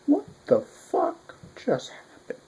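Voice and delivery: high, slow, stunned disbelief. Retold or imitated. Reader recalling the chapter, high slow shocked disbelief. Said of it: "I was like".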